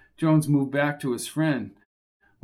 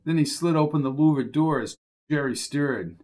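ordinary delivery

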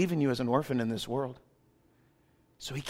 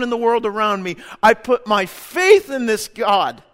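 loud, driving sermon delivery; preaching at full force; 5 semitones higher, 6 dB louder than usual. Acted. Pastor urging the congregation to trust, preaching loudly. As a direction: preach it hard and build to the peak.

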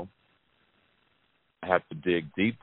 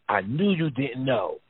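lightly voiced daughter; 5 semitones higher, slower than usual. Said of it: "And she says".

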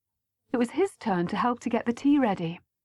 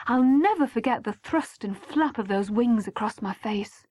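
ordinary delivery, nothing marked

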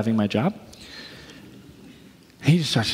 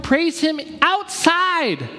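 much higher, slow, stretched proclamation; drawn-out preaching emphasis; 13 semitones higher, much slower than usual. Acted. Preacher calling the church outside, slow high proclamation. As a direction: lift each word slowly toward the heavens.

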